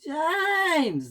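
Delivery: high, stretched shriek. A narrator's reading. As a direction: shriek the name in protest. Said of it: "they shrieked".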